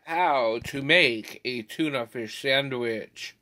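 ordinary delivery

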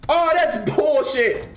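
high, slow put-on complaint; mock outrage. Streamer losing a chase, slow high mock complaint. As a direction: whine it in the silly voice.

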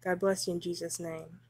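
ordinary talk

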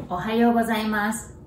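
usual speaking voice

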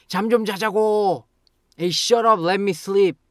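high, loud, angry shout; frustrated outburst. Shown as a demonstration. Sleepless person to people who keep talking, frustrated. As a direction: explode at them, loud and high. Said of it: "explode with frustration".

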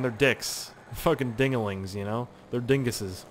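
ordinary delivery

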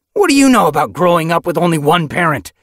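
high, loud snarling question; snarled. Acted. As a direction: snarl it bitterly. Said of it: "Ragged Pelt snarled".

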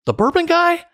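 high, amazed question; light up, suddenly recognizing him; surprised recognition. Retold or imitated. And the guy was Australian.

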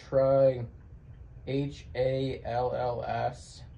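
ordinary delivery

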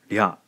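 "rya" with a light tapped r, one syllable; Japanese rya. Shown as a demonstration. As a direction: model "rya" for learners. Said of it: "we end up with rya".

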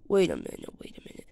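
hushed, whispered "Wait a minute"; whispered realization. Pretend. Realizing the monster has gone, whispered "Wait a minute". Same words dropped to a whisper.

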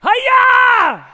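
high, explosive shout; martial-arts yell. Pretend. Magician opens the kids' show with a loud, high battle cry. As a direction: let out a big karate cry.

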